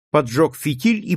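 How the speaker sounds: ordinary delivery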